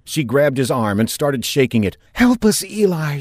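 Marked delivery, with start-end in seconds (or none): 2.1-3.2 high, loud desperate yell; desperate high shout. Acted. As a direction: yell while shaking his arm. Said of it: "she yelled".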